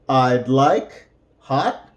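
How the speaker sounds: slow, careful model phrase; slow demo. Shown as a demonstration. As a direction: say it slowly for learners. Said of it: "this is how it might sound".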